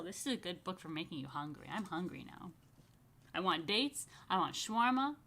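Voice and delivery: usual speaking voice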